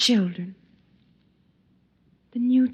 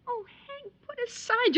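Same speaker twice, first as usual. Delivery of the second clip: higher, softer, cracking with tears; tearful plea; 10 semitones higher, 7 dB quieter than usual. Acted. Wife pleading tearfully with her armed husband. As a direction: beg through tears.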